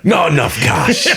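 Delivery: high Cockney merchant, breaking into laughter; laughing through the merchant impression. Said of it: "my Resident Evil four merchant voice".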